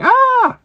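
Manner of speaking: loud, very high excited cry; retold squeal of recognition; delighted shriek. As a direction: shriek at the sudden realization. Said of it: "And it's like".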